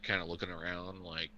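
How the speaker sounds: normal talking voice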